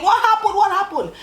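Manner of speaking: high-pitched panicked mother's cry; screaming mom. Retold or imitated. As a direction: imitate mom shrieking in alarm, pitch way up. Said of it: "my mom ran out screaming".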